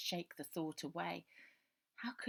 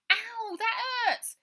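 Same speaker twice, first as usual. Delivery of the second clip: much higher, slow drawn-out yelp of pain; pained cry; 12 dB louder, much slower than usual. Acted. Boy crying out at Ida's tight grip, pained.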